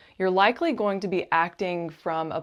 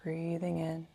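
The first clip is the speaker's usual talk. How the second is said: guided meditation; 4 dB quieter than usual. Acted. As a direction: gently guide the listener into calm, unhurried and soothing.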